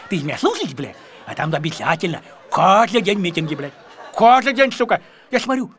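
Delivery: usual speaking voice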